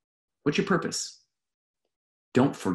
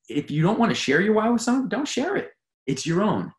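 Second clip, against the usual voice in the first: ordinary talk, nothing marked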